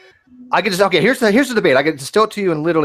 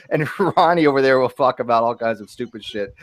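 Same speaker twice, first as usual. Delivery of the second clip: normal talking voice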